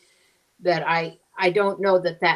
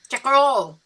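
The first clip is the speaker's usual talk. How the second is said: high, run-together Texas twang; West Texas accent; 7 semitones higher, faster than usual. Retold or imitated. Gas station man repeating himself, thick West Texas accent. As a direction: repeat "Chickarole" as one Texan word, high-pitched. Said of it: "this guy said".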